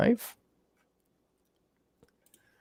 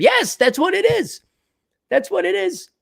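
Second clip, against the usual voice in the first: mock agreement. High mocking agreement.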